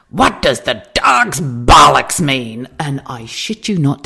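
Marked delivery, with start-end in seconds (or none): 0.1-2.7 slow, loud Texan holler; shouted Texan question. Retold or imitated. Texan customer shouting across the whole restaurant, loud Texan holler. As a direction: boom it out in a Texan accent. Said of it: "he shouted it out across the whole of the restaurant".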